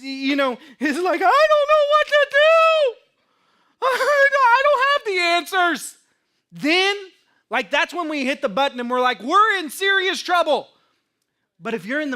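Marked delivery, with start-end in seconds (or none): 1.2-6.0 much higher and louder, hammed-up helpless panic; mock panic. Pastor joking about Jesus himself losing it, hammed-up high panic. 9.2-10.7 higher, louder mock alarm. Congregation imagining hitting the panic button, mock alarm.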